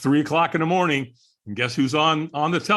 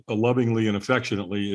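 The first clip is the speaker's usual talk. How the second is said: ordinary talk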